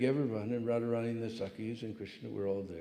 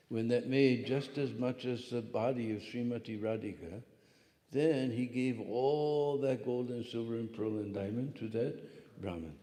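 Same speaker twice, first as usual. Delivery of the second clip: usual speaking voice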